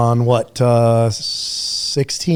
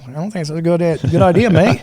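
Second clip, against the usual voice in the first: higher-pitched, rough attempt at an Australian accent; butchered Australian accent; 4 semitones higher than usual. Australian soldier turning down a swim, in a butchered Australian accent. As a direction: do a bad Aussie mate. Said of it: "butcher my fucking Australian accent".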